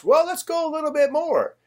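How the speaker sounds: high-pitched, put-on casual urging; coaxing mock suggestion. Stalling tired friend on surprise mission.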